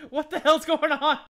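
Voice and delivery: high, loud acted bewilderment; alarmed shout. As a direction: yell in confused alarm, half amused. Acts out character's alarm at scream.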